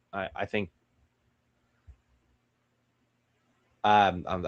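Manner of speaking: halting, stumbling start; tripping over the words; stuttering. Real, not acted. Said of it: "I don't know why I'm stuttering".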